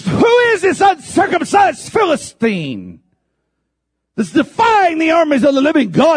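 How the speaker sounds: high, loud, ringing challenge; David shouting down Goliath; defiant shout. Acted. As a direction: hurl it across the valley at the giant.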